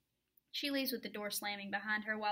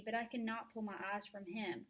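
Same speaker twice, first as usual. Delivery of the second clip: plain reading voice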